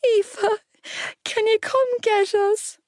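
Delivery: put-on high, pleading little boy; young child's voice. A narrator's reading. Little boy phoning for rescue, pleading in a young voice. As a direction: plead in a small, upset child voice. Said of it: "A young voice came down the line".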